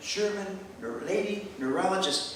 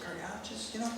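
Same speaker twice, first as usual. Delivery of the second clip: much softer whisper; 3 semitones higher, 12 dB quieter than usual. Retold or imitated. Whispering to the flight attendant, soft whisper. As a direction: barely breathe the word. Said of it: "I just sort of whispered".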